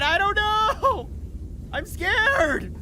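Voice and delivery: high, loud, slowed mock-crying whine; mock whimper. Streamer to chat, half-joking mock whimper. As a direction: whimper like a scared kid, hammed up. Overwhelmed by the game.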